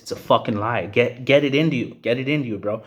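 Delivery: normal talking voice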